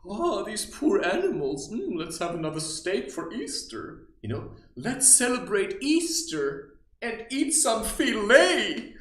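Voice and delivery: loud, high mocking sing-song; mock hypocrite. Mocking meat-eaters celebrating Easter, loud parody voice. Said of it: "they say".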